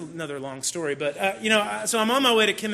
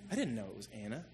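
softer, lower echo of "I didn't know it was Anna"; quiet repeat; 8 semitones lower, 13 dB quieter, much faster than usual. Real, not acted.

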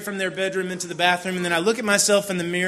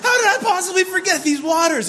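a huge high shout of dismay, laughing through it; inner scream; 13 semitones higher, 9 dB louder, slower than usual. Retold or imitated. Dad screams inwardly at forgotten waters, huge shout. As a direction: erupt like a volcano inside, then laugh. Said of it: "On the inside, I go".

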